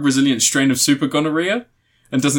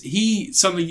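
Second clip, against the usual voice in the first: ordinary talk, nothing marked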